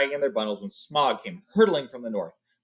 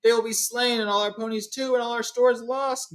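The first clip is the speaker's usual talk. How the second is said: moaning lament; 12 semitones higher than usual. Acted. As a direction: moan the losses. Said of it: "moaned the others".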